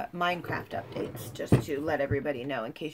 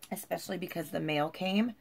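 ordinary talk

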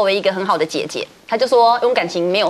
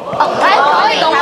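mimicking producers; 8 semitones higher than usual. Producers calling her to a meeting, casual call. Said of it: "都会叫我一辉".

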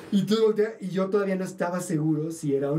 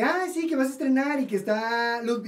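high, drawn-out gushing without real loudness; mock excited yell; 6 semitones higher than usual. Storyteller greeting a director about a premiere, mock excited yell. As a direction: gush over a premiere, pitched up. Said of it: "lo grité porque es estreno".

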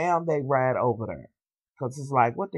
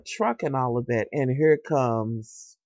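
ordinary delivery

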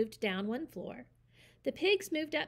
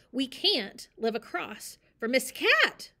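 louder, slow mouse voice; squeaking; 3 semitones higher, 7 dB louder, slower than usual. Acted. Mister Mouse pleads with the owl, squeaking. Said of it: "squeaked Mister Mouse".

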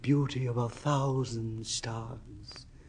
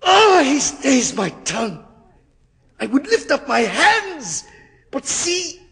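much higher, louder panicked cries; terrified screaming; 9 semitones higher, 5 dB louder than usual. Acted. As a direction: scream it as devils seize you. Faustus in terror, held by devils.

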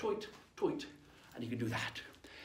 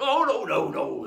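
scoffing pirates; 8 dB louder, slower than usual. Acted. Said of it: "The other guys went".

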